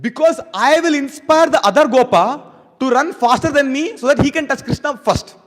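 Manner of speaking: high, loud and slow with eager resolve; eager boast. Acted. Gopa running behind Krishna, loud eager and slow. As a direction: declare it like a keen young racer. Acting the gopas' running mood.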